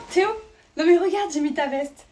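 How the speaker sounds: ordinary talk, nothing marked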